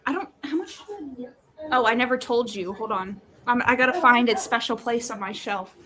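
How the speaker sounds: normal talking voice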